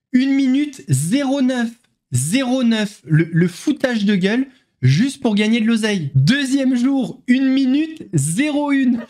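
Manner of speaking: infomercial. Acted. Mocking ad-style countdown, cash sound.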